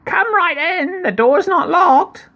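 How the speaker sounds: very high, quavering mock old lady; wolf squeaking like a granny. Wolf inviting the girl in, high mock old lady voice. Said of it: "in his best old lady voice".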